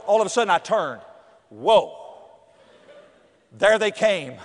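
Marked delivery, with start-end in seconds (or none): none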